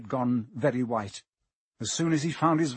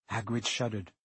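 ordinary delivery, nothing marked

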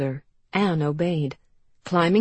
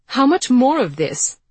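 high, loud demand; 8 semitones higher, 8 dB louder than usual. Acted. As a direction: demand an answer now. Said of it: "she demanded".

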